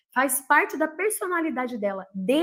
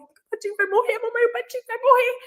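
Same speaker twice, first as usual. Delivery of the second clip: rising, higher wail, building toward mamãe; imitating crying child; 9 semitones higher than usual. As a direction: mimic a little girl pleading through tears. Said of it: "a Maria começou a chorar".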